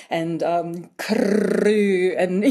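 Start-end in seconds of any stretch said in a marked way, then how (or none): 1.0-2.1 CREW with a long, loud trilled R after the C; rolled R. Shown as a demonstration. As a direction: draw out a heavy trill in "crew". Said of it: "really roll their R's".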